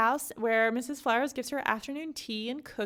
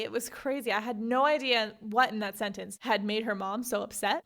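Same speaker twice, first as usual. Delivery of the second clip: usual speaking voice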